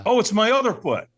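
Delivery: as if it just dawned on you; surprised realization. Retold or imitated. Checking feet for burns.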